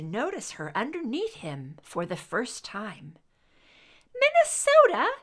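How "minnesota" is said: put-on Minnesota announced very high and loud by a little boy; child announcing. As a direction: proclaim Minnesota like a bossy five-year-old. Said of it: "he announced to her".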